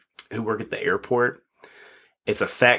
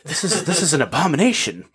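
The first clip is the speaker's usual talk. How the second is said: appalled, higher-pitched and halting; mock indignation; 5 semitones higher than usual. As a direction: act scandalized for laughs, drawing it out. Acting an appalled replacement actor.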